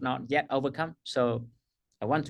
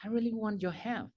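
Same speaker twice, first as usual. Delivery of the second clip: client's soft yes; 4 semitones higher, 9 dB quieter than usual. Retold or imitated. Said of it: "And then just say".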